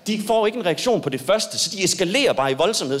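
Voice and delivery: normal talking voice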